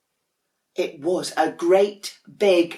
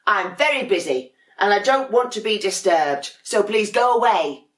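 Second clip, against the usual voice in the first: louder, exasperated complaint; fed-up penguin; 5 semitones higher, 7 dB louder than usual. Acted. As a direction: huff it out, fed up with the cuddling. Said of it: "said Dudley".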